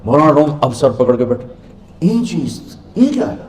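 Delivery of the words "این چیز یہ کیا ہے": bewildered question rising high; mild hammed-up astonishment; stunned disbelief. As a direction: ask it dumbfounded, head in hands. Rumi, head in hands, asks.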